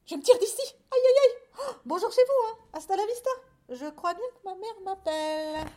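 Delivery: very high, loud squeals of alarm; panicked mice. Acted. Mice escaping the big cat, comic panic. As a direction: shriek excuses while fleeing.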